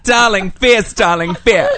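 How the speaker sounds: a hint of a camp British accent; light posh British darling; mock British accent. She keeps up the mock British accent for fun, lightly. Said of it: "Did you say that in a British accent?".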